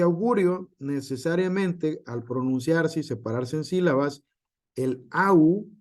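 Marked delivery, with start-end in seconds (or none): 4.8-5.7 au said as one gliding sound; au as one syllable. Shown as a demonstration. Teacher highlighting the diphthong to the class, pointed. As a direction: stress the au. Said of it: "queda en una misma sílaba".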